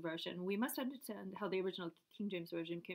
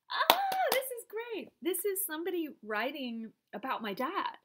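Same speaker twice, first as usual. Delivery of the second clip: high delighted discovery; thrilled by the find; excited; 9 semitones higher, 6 dB louder than usual. Real, not acted. Finds writing about her dad.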